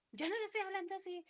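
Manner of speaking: voz de hija aguda, rápida y fuerte; hija alzando la voz. Retold or imitated. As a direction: imitar a la hija que contesta alzando la voz. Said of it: "ella me elevó la voz". (la hija replica a su madre, aguda, fuerte y rápida)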